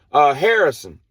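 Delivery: high, loud call on the radio; walkie-talkie call. Retold or imitated. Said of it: "she calls up".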